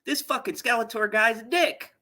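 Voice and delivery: high-pitched imitation of a fed-up villainess; hammed-up indignant resolve; mock sudden decision. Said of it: "She decides".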